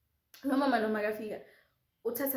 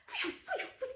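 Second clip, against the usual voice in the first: whooshing car noise; 6 dB quieter than usual. Retold or imitated. Speeding car sounds.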